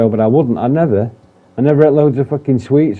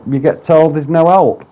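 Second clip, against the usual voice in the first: ordinary talk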